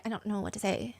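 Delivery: soft, helpless aside; awkward murmur. Acted. Onlooker at an awkward gathering, quiet awkward murmur. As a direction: say it small and at a loss.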